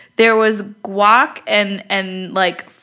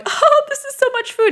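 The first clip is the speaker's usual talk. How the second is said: amazed exclamation. Retold or imitated. Laughing at huge food delivery.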